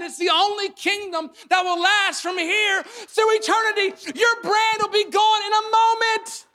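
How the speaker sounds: high, loud preaching at full force; impassioned preaching. Real, not acted. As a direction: thunder the rebuke to the congregation. Preacher at full force against brand building.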